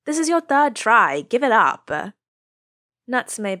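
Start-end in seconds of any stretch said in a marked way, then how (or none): 0.1-1.9 exasperated. A narrator's reading. Kitamoto exasperated.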